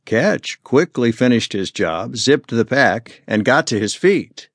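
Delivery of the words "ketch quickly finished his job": ordinary delivery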